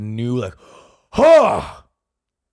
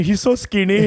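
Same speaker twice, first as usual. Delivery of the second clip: higher voice of a fussing mother; imitating mom; 9 semitones higher, 3 dB louder than usual. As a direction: coo like a worried mom.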